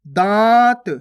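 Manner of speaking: दांत with a long nasal vowel, no n consonant; nasalised vowel. Shown as a demonstration. Teacher modelling chandrabindu, loud long nasalised दांत. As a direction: draw out दांत through nose and mouth. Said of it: "चंद्र बिंदु की मात्रा लगी तो".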